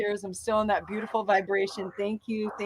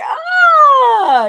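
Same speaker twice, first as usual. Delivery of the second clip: excited ah; 13 dB louder than usual. Retold or imitated. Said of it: "I was like".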